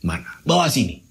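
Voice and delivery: put-on low, gruff old man voice; a stern grandfather brushing him off; low-pitched old man demanding the thing.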